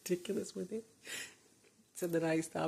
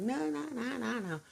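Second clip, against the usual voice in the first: daughter refusing; 7 semitones higher than usual. Retold or imitated. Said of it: "she's like".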